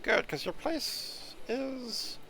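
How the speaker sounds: high, languid cat voice; snooty bored cat. Acted. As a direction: drawl it like an aloof cat. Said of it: "do the cat voice".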